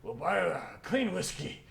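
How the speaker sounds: loud, high orc inviting friends to drink; rowdy drinking buddy; boisterous orc. Acted. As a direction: holler a hearty invitation across the tavern. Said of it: "orc, and goes".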